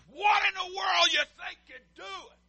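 showing the church what indignation sounds like; indignant. Shown as a demonstration. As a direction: blast the question like an offended elder. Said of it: "See, I can be indignant too".

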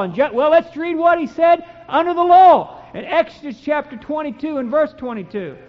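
loud, solemn preaching of the law; fervent scripture reading. Acted. Preacher reading Exodus to his congregation, loud and fervent. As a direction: proclaim each verse like a warning.

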